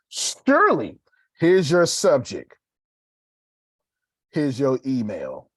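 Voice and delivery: high, slow, obliging assistant voice; ChatGPT assistant. Retold or imitated. ChatGPT answering an email request, voiced high and slow.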